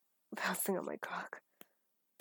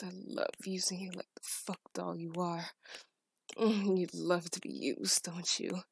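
ordinary delivery

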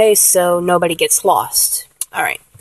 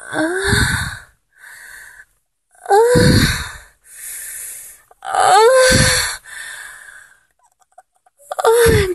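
airy moans climbing much higher; breathy high moans; much slower than usual. Shown as a demonstration. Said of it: "make sure it gets really, really breathy".

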